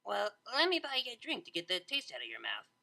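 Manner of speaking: lightly voiced Vinyl, offering; smooth over the embarrassment; Vinyl suggesting. A narrator's reading. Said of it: "Vinyl suggested".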